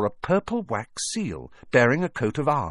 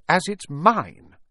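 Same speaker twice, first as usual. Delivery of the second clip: slow, high, loud insistence; furious; 10 semitones higher, 5 dB louder, slower than usual. A narrator's reading. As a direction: claim it angrily, drawn out. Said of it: "said Harry furiously".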